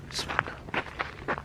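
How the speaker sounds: drawn-out whisper of bismillah; slow whispered prayer. Real, not acted. Hearing strange sounds in the graveyard, a slow whispered prayer. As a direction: stretch the prayer out in a hushed breath.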